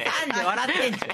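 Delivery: high, fast, laughing. Real, not acted. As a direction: crack up mid-sentence. Laughing at failed take.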